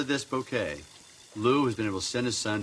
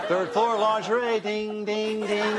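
higher, slow store elevator call; elevator operator; 9 semitones higher, slower than usual. Acted. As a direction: chime out the floors like a lift attendant. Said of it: "elevator operator bit".

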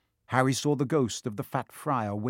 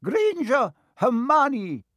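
calling out a name; 12 semitones higher, 5 dB louder, much slower than usual. A narrator's reading. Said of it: "Professor McGonagall calling names".

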